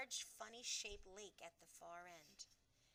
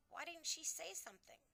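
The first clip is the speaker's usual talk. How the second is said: plain reading voice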